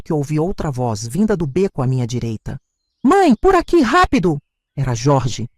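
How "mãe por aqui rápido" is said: urgent call. Acted. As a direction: call her urgently from the alley. Filho chama a mãe no beco. (filho chama a mãe do beco, urgente e alto)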